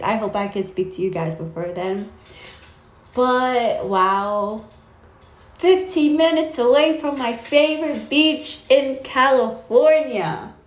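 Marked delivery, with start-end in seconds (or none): 3.1-10.5 higher, louder burst of delight; real excitement. Real, not acted. Telling viewers about the move near the beach, thrilled. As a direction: gush about the beach near the new home.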